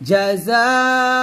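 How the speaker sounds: জাযাআম with the যা stretched four counts and the মিম nasalised; four-count madd. Shown as a demonstration. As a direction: chant জাযাআম long and loud, humming the mim. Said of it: "জাতে চার টান দিবেন". (জাযা-তে চার টান, আম-এ গুন্নাহ)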